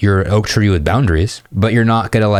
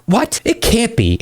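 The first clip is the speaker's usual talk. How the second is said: put-on much higher, astonished girl; imitating the girl stunned by the distance; girl's disbelief; 14 semitones higher, slower than usual. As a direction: play her shocked and high.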